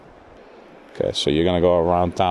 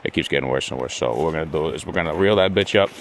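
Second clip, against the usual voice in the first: ordinary talk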